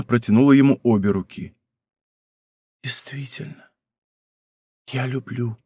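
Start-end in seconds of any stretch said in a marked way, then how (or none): 2.8-5.6 barely audible confession; whisper. A narrator's reading. Princess confessing so only the count hears, whispered. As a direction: breathe it out very softly. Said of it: "прошептала она так тихо".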